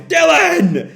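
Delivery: high, loud anguished shout; acted grief-stricken yell of a name; anguished call. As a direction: cry out for a lost comrade. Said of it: "Ibaraki calls, tears in his eyes".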